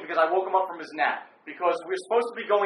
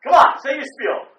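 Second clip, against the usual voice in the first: high, shouted urging; loud goading; 7 semitones higher, 9 dB louder than usual. Real, not acted. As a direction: push a reluctant partner, loudly. Goading co-host to do his intro.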